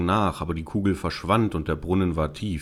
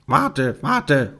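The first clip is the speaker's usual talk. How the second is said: high-pitched frog call; 6 semitones higher than usual. Acted. Frog calling after the fleeing princess, urgent. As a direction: call after someone running off. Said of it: "rief der Frosch".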